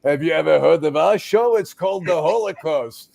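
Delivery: high, mock-proud old man's voice; the old man boasting about his own show; old survivor. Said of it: "and the guy says".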